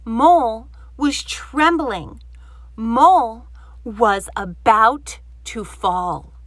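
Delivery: slow, loud, tense narration; suspenseful. A narrator's reading. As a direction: build the dread slowly. Dream suspense.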